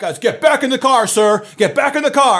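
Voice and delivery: high, loud barked commands; hammed-up officer yelling orders; mock cop orders. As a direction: order him back like a cop. Officers ordering him back.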